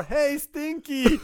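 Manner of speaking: high, slow, strange-voiced yell; mock roar. Woman from the play calling across the shop, hammed-up roar. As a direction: belt it across the shop at him. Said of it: "And that's this roar".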